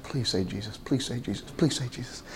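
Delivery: low, hushed inner plea; silent pleading. Retold or imitated. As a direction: repeat it under your breath like a private prayer. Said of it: "I'm thinking in my heart of hearts".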